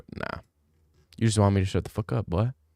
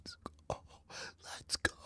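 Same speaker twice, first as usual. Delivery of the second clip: hushed whisper; barely audible whispered hype; 20 dB quieter than usual. Real, not acted. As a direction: whisper it under your breath. Whispered hype aside, far softer.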